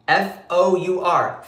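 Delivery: slow letter-by-letter F O U R; spelled out. Real, not acted. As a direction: spell it out to rub it in. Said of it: "spelling out for emphasis".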